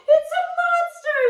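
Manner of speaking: Mama Bear seeing Goldilocks, high and loud; frightened Mama Bear. Acted. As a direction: shriek about a monster. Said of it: "said Mama Bear".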